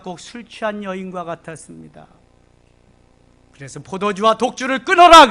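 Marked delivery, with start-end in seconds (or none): 3.8-5.3 sharp, louder rebuke; priest's rebuke. Acted. Preacher playing the priest who thinks Hannah is drunk, stern. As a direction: scold her like a stern priest.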